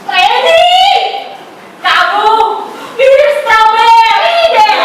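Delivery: high, loud, drawn-out call across the field; boy yelling happily to a friend across the yard; excited shout. Acted. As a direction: shout it out gleefully, slowing on the last words. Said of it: "dan ia berteriak".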